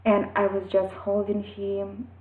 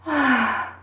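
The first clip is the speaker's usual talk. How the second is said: long sigh as the crying ends; relieved sigh; 5 semitones higher than usual. Retold or imitated. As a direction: exhale like the child finally done. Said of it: "doing like".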